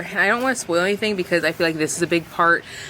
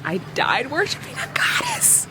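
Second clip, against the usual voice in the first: breathy awed whisper, higher; swooning whisper; 7 semitones higher than usual. Real, not acted. Reader swoons over her favorite book line, whispering. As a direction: whisper it like a secret you can't get over.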